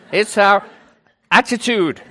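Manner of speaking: loud, high Brummie imitation; exaggerated Birmingham accent. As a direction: lay the Brummie on thick. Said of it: "your Birmingham accent isn't quite that strong".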